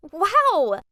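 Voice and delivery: a loud, bursting high "Wow!"; amazed wow. Acted. Narrator amazed at her friend's rich family, loud wow. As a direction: gasp out a wow in awe.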